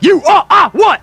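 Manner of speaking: high, loud shouted question; hammed-up badgering shout; drill-style demand. Host demands louder answer.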